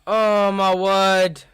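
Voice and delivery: slow, loud, disbelieving groan; exasperated. Real, not acted. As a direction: drag it out in disbelief. Reacting to a yellow card.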